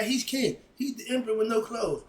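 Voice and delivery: usual speaking voice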